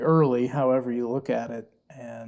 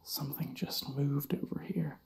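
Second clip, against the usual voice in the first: whispering; 9 dB quieter than usual. Real, not acted.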